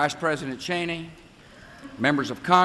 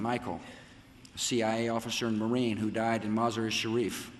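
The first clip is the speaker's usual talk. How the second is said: lower, softer, sombre remembrance; solemn tribute; 5 semitones lower, 4 dB quieter than usual. Real, not acted. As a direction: honour the dead in a subdued, lowered voice. Honoring the dead and bereaved.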